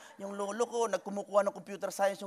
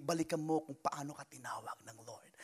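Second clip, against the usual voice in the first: whisper; 8 semitones lower, 10 dB quieter than usual. Real, not acted. Preacher drops to hush.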